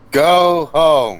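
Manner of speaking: loud, high, drawn-out order; calling out. Acted. Hannibal sending off the followers, calling out loudly. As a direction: holler it to a crowd behind you. Character dismisses crowd of followers.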